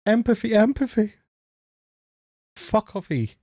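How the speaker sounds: high, taunting parody of her; mock her in a silly high voice; mocking singsong. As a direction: scoff at the word empathy. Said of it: "she's just like".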